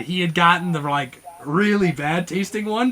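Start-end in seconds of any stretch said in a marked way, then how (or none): none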